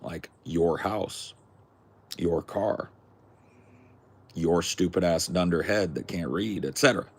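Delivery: ordinary delivery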